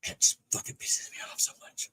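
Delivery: unvoiced, hushed angry venting; muttered frustration. Real, not acted. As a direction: say it under your breath, fed up. Venting anger in a hush.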